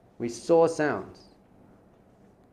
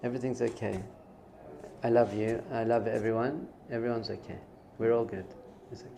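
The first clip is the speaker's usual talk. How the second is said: small, gentle, reassuring voice; softly soothe, little pauses between; little inner voice; 2 semitones lower, 6 dB quieter than usual. Acted. Soul reassuring the listener from within, little soft voice. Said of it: "this little voice inside you".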